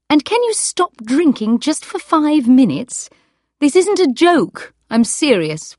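high and loud, insisting; no joking, she means it; angry. Acted. Wife insists husband stops drinking.

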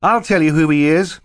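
high, loud accusation; urgent boy. Acted. Klaus interrupts to expose Stefano, urgent and loud. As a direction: burst in to expose him.

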